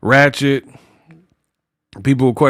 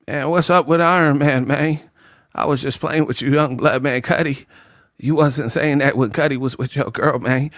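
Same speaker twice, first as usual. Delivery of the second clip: Terrence Howard impression; 4 dB quieter than usual. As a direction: play it cool and hushed like Terrence Howard. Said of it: "Like Terrence Howard".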